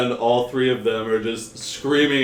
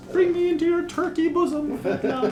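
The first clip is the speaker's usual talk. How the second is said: high-pitched pleading prayer; desperate praying; faster than usual. Acted. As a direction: beg the god for release in a thin high voice. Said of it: "starts praying to, uh, Fetlan".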